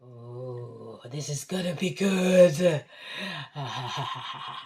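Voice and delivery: low cackling witch voice. Real, not acted. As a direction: gloat over the pot like a witch. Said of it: "I sound like a witch".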